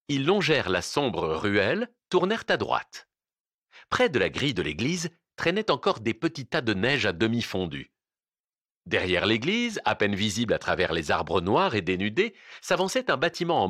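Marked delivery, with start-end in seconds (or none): none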